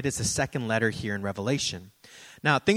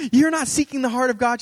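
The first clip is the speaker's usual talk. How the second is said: much higher, louder mock scolding; scolding prophet; 11 semitones higher, 9 dB louder, faster than usual. Lady prophesying over the pastor, loud mock scolding. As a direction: tear into him like an overzealous prophet. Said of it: "she's like".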